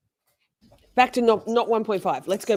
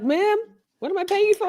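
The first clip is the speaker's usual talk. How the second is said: much higher, loud indignant voice; indignant client; 13 semitones higher, 4 dB louder than usual. Pretend. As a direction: squeak it out, outraged. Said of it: "She's like".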